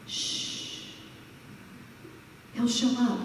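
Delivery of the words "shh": a gentle hushing shh; shushing to calm everyone; soft shush. Shown as a demonstration. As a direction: hush the room softly. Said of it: "We need to just calm down".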